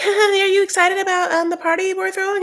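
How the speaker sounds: mocking the mom in a high put-on voice; imitating the mom. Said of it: "Jovi's mom is also like".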